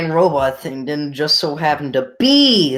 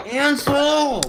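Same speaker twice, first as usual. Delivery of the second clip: indignant shout; 12 semitones higher than usual. Real, not acted. Friend calls out the teaser.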